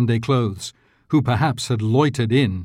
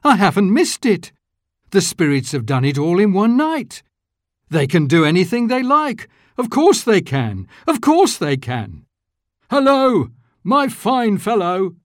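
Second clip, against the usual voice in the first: bursting with relief and glee; joyful old Scrooge; 9 semitones higher than usual. A narrator's reading. Scrooge rejoicing to himself, then hailing the boy.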